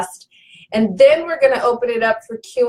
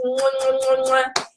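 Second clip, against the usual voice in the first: soft smacking air kisses; playful kiss sounds, a bit softer; blown kisses; 4 dB quieter than usual. Real, not acted. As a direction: blow kisses at the camera. Blowing kisses to viewers.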